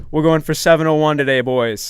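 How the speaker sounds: rally the boys for a pretend record; mock hype. Customer aiming for a window-time record, mock pumped-up.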